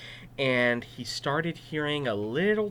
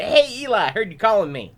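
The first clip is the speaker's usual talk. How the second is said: put-on higher, louder young voice; eager boy; 8 semitones higher, 7 dB louder, faster than usual. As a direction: burst in cheerfully. Boy bursts into room.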